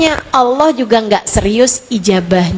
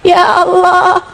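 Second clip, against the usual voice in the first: acted tearful prayer; 4 semitones higher than usual. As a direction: plead to God as if through tears. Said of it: "kamu berdoa dengan linangan air mata".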